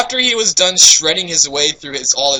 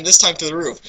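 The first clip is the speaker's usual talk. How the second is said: usual speaking voice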